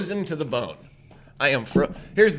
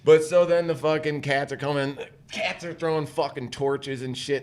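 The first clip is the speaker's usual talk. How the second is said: ordinary talk, nothing marked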